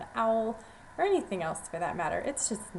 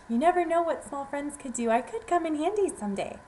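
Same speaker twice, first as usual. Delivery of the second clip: higher and louder, pressing the case; eager pleading; 5 semitones higher, 4 dB louder than usual. Acted. Mouse urges the lion she could be useful, eager and loud. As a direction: convince him, eager and loud.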